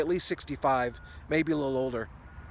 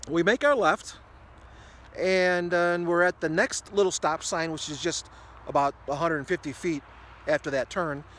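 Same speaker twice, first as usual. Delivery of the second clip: ordinary delivery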